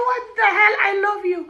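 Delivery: hammed-up incredulous squeal; laughing mock protest. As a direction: protest through giggles. Mock protest to angry husband.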